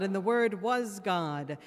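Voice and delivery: ordinary talk, nothing marked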